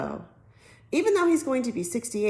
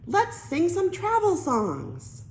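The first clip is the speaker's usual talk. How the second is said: high, slow, sing-song mom voice; slow, lilting mock-cheerful mom; mom's cheery suggestion; 9 semitones higher, slower than usual. A narrator's reading. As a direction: chirp it out like an eager mother. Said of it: "said the dreaded words".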